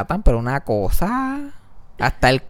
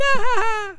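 high wail; 4 dB louder than usual. Retold or imitated. Laughing and crying at once.

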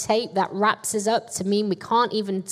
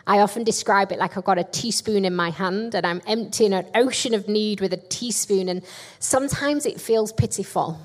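ordinary talk, nothing marked

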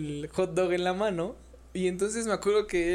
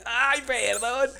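very slow, higher, drawn-out ay perdón; hammed-up sheepish ay perdón; sheepish apology; 5 semitones higher, much slower than usual. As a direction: stretch out a guilty apology, higher. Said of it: "Y yo así como de".

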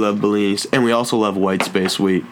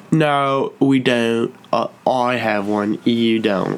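amateur imitation of an English accent; attempted English accent; much slower than usual. English lady disputing who has an accent, imitated English accent. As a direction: mimic an English lady's accent, drawn out. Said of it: "I can't even do an English accent".

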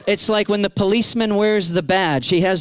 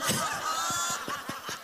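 much higher, airy laugh of delight; high joyful laugh; 9 semitones higher, 14 dB quieter than usual. Retold or imitated. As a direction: let out a light delighted laugh. Woman laughing in retelling.

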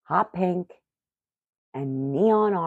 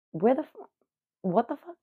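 shocked; 8 semitones higher than usual. Retold or imitated. Shocked at the pants order.

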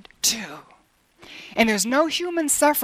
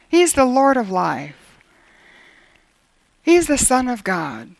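usual speaking voice

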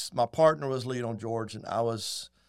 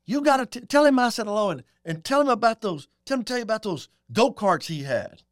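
Lionel's voice; 8 semitones higher, 5 dB louder than usual. Retold or imitated. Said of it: "he goes".

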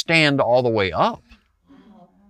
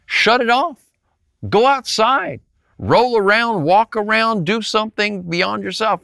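much higher, urgent exhortation; fired-up urging; 10 semitones higher than usual. Real, not acted. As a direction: push them out the door with rising fervor. Preacher urging listeners outdoors.

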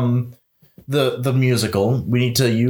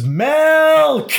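very high, loud held shriek; imitated scream; 5 dB louder than usual. Host imitating the cat in Tugger's song, screaming. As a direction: scream the word out, stretching it. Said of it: "where he just screams".

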